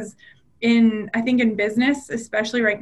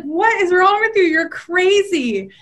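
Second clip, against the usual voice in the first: higher mock exasperated husband; retold husband; 7 semitones higher, 3 dB louder, slower than usual. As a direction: toss it back as the baffled husband. Husband retorting in retold quarrel.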